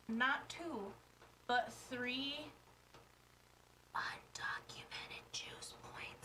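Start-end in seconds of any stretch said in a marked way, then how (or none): 4.0-6.3 hushed secret whisper. Real, not acted. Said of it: "I should say that a little bit louder".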